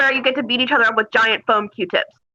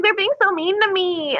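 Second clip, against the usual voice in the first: whining; 7 semitones higher than usual. Retold or imitated. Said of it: "She was, like, whining".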